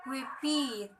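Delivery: repeat, stressed on the second syllable. Shown as a demonstration. Teacher showing an example, second-syllable stress on repeat. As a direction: say repeat softly, weight on its last syllable. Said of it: "Stress on second syllable".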